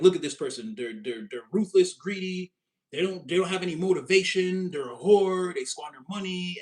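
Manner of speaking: loud, scornful listing of faults; judgmental scorn. Retold or imitated. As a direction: sneer it like a gossip judging. Mocking a judgmental onlooker.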